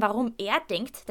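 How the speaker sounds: plain reading voice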